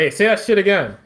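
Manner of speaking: hammed-up tough-guy challenge; mock angry challenge. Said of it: "He's just like".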